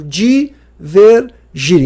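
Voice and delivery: Divergir stretched very slowly, every syllable held; slow emphatic definition. Shown as a demonstration. Teacher to students, contrasting terms, very slow and emphatic. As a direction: drag Divergir out so it sinks in. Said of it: "Que que é de encontro?".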